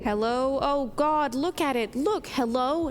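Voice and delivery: a touch of desperate pleading; desperate plea. Acted.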